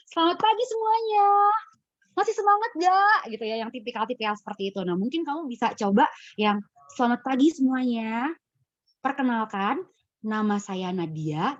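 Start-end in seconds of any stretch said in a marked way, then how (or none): 0.1-3.2 much higher, loud, rushed MC greeting; fiery energetic opening. Shown as a demonstration. Coach imitating Nadia's typical fired-up opening. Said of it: "biasanya gaya Nadia". 6.9-11.6 slow, measured introduction; slow and calm. Shown as a demonstration. Coach showing Nadia a slow, calm self-introduction. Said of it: "Jadi kamu pelan, pelan gitu ya".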